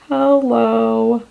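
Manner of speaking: bright, drawn-out hello to a shade; mock greeting to a swatch; delighted hello. Real, not acted.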